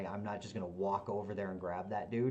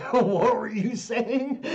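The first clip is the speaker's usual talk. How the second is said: high, loud, slow laughing question; laughing hard; 14 semitones higher, 15 dB louder, much slower than usual. Real, not acted. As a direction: crack up while asking. Bursts out laughing at lie.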